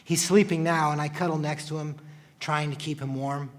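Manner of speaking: ordinary delivery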